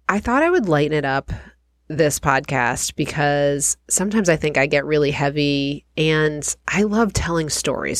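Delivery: usual speaking voice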